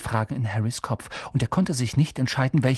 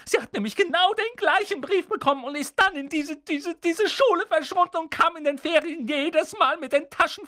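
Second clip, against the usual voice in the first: much higher, shrill, jealous tirade; bitter rant; 14 semitones higher than usual. A narrator's reading. Aunt Petunia rants about her sister, shrill and much higher. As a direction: spit out years of envy.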